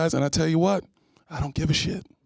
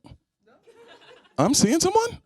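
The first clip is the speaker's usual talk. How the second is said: high stammered outrage, much higher; flustered mock woman; 13 semitones higher than usual. Comic rebuffing a woman asking about wings, high flustered stammer. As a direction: stammer like a taken woman offended. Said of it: "I said".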